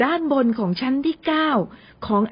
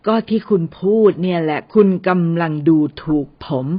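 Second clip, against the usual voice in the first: a lower, put-on huffy voice; mock offended; 6 semitones lower, 3 dB louder than usual. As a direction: pretend to be insulted, lower and firm. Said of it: "อาทิตย์ทำเสียงโกรธที่ถูกดูถูก". (แกล้งทำเสียงโกรธที่ถูกดูถูก)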